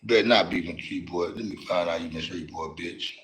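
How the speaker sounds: quiet menacing mutter; muttered threat. Real, not acted. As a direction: mutter it under your breath. Muttered threat, much softer.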